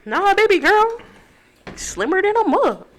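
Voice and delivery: high, sugary reassuring voice; high mock sweet voice. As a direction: coo it like a smitten boyfriend.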